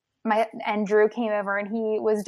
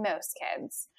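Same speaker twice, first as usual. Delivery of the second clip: ordinary talk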